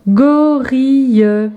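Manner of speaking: drawn-out teacher's demonstration; syllable by syllable. Shown as a demonstration. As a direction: chant out "Gorille" piece by piece. Syllable exercise, picture word.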